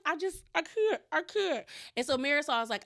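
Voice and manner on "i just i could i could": high-pitched mock crying voice; imitated crying. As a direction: imitate her breaking into tears, much higher. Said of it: "she just starts crying".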